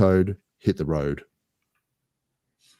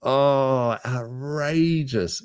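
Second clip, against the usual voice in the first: mock outrage; 6 semitones higher, much slower than usual. Real, not acted. Reacting to wrong answer.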